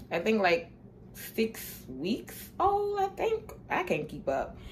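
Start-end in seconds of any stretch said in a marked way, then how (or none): 1.3-3.5 hushed high cooing about the kitten's age. Real, not acted. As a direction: coo it softly to the kitten.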